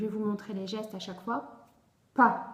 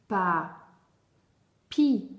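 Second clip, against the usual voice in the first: "pas, pi" loud, with a long pause between; "pas, pi" split into two held, projected syllables; 7 dB louder than usual. Shown as a demonstration. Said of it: "Alors, première syllabe".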